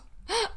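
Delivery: sharp high theatrical gasp; dramatic gasp. Pretend. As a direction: gasp like a fainting starlet. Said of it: "an old Hollywood actress".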